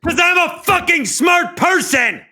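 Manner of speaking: high, loud joking boast; mock shout. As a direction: yell it like a proud brag.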